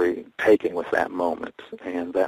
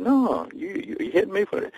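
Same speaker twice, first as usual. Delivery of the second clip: high protest. Retold or imitated. Protesting to the biker.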